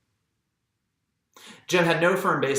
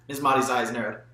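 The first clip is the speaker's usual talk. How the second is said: ordinary delivery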